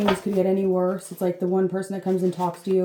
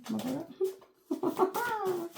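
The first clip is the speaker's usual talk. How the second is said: drawn-out sassy retort; 10 semitones higher, 8 dB quieter, much slower than usual. Pretend.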